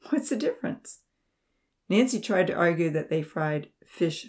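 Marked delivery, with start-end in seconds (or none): 0.1-1.0 argumentative. A narrator's reading. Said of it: "Franz argued".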